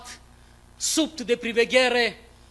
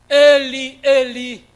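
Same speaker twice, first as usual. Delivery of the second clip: louder, crying out Eli in agony; anguished cry; 7 dB louder, slower than usual. Acted. Dying Jesus crying out to his Father, anguished loud cry. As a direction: call out to God in pain. Crucified Christ cries out.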